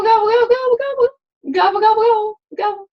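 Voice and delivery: high loud gobbling, fading at the end; playful turkey gobble. Pretend. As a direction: gobble like a turkey, then wind down. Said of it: "we can pretend that we're turkeys".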